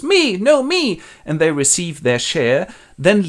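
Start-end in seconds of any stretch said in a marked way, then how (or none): 0.0-1.0 much higher, shrill-free squeal of me me; squeaky eager daughter. Acted. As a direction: jump in like a greedy child. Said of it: "And the elder goes".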